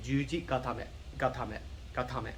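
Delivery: "gatame" with even syllables, ending in a short "meh"; correct Japanese pronunciation. Shown as a demonstration. Teacher showing students how you kinda say it, careful model pronunciation. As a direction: say "Juji gatame" evenly, no punched syllable. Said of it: "that's how you kinda say it".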